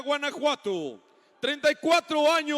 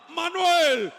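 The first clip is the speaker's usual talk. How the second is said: announcer shout; 5 semitones higher, 5 dB louder, slower than usual. Real, not acted. Announcer welcomes second fighter.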